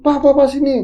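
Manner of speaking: loud, high call for dad; urgent call. Retold or imitated. Child calling his father into the room, loud and high. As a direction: yell for dad to come quickly.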